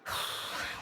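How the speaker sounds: long, soft exhale; heavy sigh. Acted. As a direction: let out a heavy breath. Relief after heavy confession.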